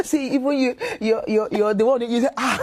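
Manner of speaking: words squeezed out through high laughter; laughing so hard the voice goes high; laughing while talking. Real, not acted. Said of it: "the reason I went, "Ah".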